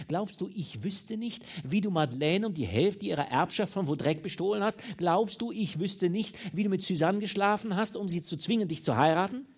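high, loud, relentless tirade; furious accusation. A narrator's reading. Mistress exposing lover's theft and seduction, furious accusation. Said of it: "Tür ihrer Wut eingebrochen".

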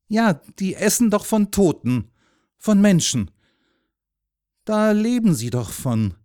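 put-on high, loud, insistent child; earnest little boy. A narrator's reading. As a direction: explain it with anxious conviction.

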